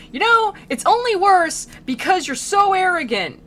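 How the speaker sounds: high, loud mock scolding; hammed-up exasperated rant; Virgil losing his temper. Guide telling off an arrogant soul, in a comic temper. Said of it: "losing his temper at this guy".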